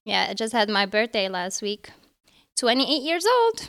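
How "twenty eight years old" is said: excited. Real, not acted. Announcing her birthday.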